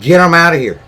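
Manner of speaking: loud, high shout; angry shout. Real, not acted. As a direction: yell it out in anger. Angry rant about refugees.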